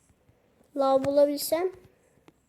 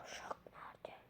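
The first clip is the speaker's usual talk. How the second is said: whisper; 19 dB quieter than usual. Real, not acted. Searching for the villager.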